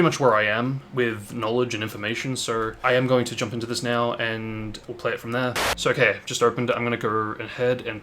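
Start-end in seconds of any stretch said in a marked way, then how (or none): none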